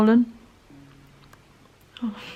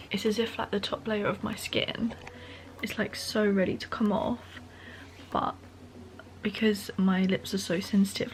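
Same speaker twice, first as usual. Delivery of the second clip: hushed, mostly softer voice; quiet at night; 6 dB quieter than usual. Real, not acted. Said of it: "I have to be really quiet because".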